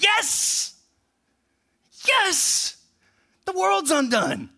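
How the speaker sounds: jubilant cheering. Retold or imitated. Preacher voicing heaven's crowd cheering the fall, ecstatic. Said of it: "the response of a whole category of people is".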